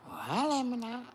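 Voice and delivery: loud, high call of Holla at me now. Acted. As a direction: shout the invitation to the viewers. Calling out to viewers.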